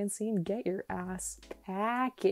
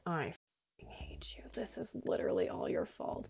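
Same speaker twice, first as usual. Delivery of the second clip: furious unvoiced whisper; seething whispered blame; angry whisper; 10 dB quieter than usual. Real, not acted. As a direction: whisper curses at the villager. Cursing the unwanted villager.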